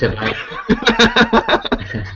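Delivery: words drawn out through laughter; slow, laughing it out. Real, not acted. Cracking up at a mate's joke about cigarettes, laughing. Joking about homemade filters.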